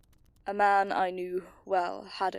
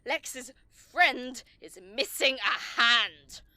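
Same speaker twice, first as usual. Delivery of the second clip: louder, higher, cutting scolding; angry rebuke; 4 semitones higher, 4 dB louder than usual. Acted. Commander scolding Paul for nearly getting everyone killed, angry. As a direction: let the anger spill over.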